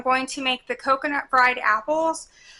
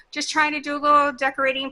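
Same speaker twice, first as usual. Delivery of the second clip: usual speaking voice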